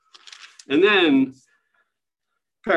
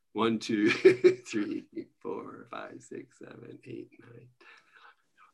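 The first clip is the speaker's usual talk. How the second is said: fading from soft counting into a whisper; counting under breath; 6 dB quieter than usual. Real, not acted. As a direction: count to yourself, trailing off into a whisper. Counting paragraphs under her breath.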